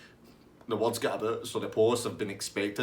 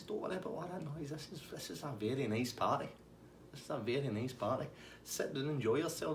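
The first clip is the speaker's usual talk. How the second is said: easy, soft, soothing the police as guests; chilled-out host; 4 semitones higher, 7 dB quieter than usual. Retold or imitated. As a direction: softly imitate an unbothered party host. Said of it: "He's just chilling out".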